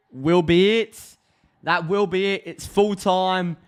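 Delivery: high, drawn-out match commentator call; full-time commentary call. Real, not acted. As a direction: announce the final whistle, pitch lifted. Calling the final whistle.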